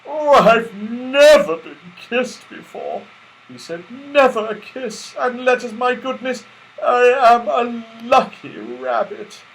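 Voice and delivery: nearly weeping with happiness; overjoyed. Acted. Rabbit after Silky's kiss, high and overjoyed. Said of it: "nearly cried for joy".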